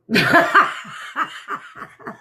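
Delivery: real laughter she can't stop; helpless laughter. Real, not acted. Laughing at a video in front of her chat.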